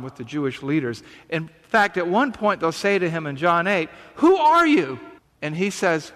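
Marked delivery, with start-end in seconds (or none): 4.2-5.0 loud and high, a challenging "who are you"; demanding shout. Retold or imitated. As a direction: hurl the question at him. Leaders demand Jesus' identity.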